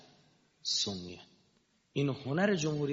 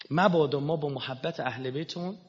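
ordinary delivery

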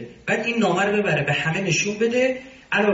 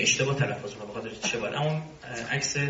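ordinary talk

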